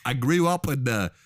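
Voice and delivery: halting, hammed-up bush Australian; bad Australian accent. Telling a bush childhood to co-host, bad Australian accent. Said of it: "can't even do an Australian accent".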